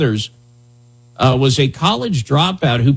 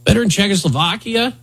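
mock official; 8 semitones higher than usual. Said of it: "They're like".

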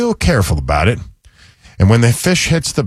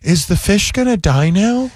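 higher-pitched imitation of a news anchor; female news anchor; 7 semitones higher than usual. Host quotes the anchor asking about the fish, female anchor voice. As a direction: put on a concerned anchorwoman's voice. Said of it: "pretty female news anchor said".

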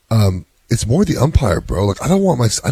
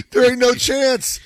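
teasing dad scoffing at his son; mock disbelief; 12 semitones higher than usual. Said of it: "I'm like".

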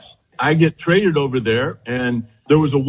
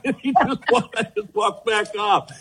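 chuckling while telling it, voice pitched up; laughing through it; 4 semitones higher, 3 dB quieter than usual. Real, not acted. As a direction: laugh your way through the line, fading off. Laughs retelling manager's retreat.

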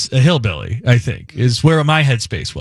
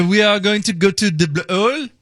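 higher-pitched mock French accent; bad French accent; 6 semitones higher than usual. Host quoting the man in Maui, bad French accent. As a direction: put on a cheesy French accent. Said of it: "this bad French accent".

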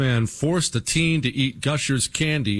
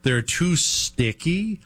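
slow, high, mocking disbelief; drawn-out disbelief; 6 semitones higher, slower than usual. Real, not acted. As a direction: stretch out the words in scorn. Repeating with disbelief.

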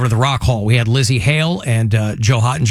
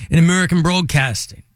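put-on Manchester accent, pitched up; 4 semitones higher than usual. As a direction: claim American roots in mock Mancunian. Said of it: "nobody knows that I'm from Manchester".

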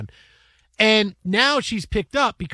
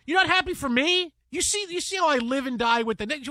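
mock hurt; 6 semitones higher than usual. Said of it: "I go".

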